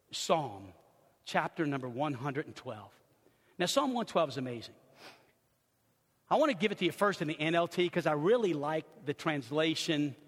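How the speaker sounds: ordinary delivery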